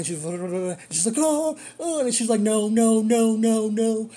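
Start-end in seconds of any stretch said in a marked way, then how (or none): 1.2-2.0 high-pitched mock wail; retold wailing, pitched way up; imitated crying. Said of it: "She was crying". 2.4-4.1 loud, drawn-out mock panic; string the words into one retold panicked wail; imitating a frantic, crying passenger; imitated freaking out. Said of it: "you know, freaking out".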